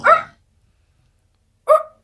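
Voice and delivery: high, loud yelp of shock; a real startled scream; shocked shriek. Real, not acted. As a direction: shriek out in disbelief. Shrieking reaction in the interview.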